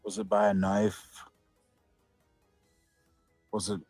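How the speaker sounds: usual speaking voice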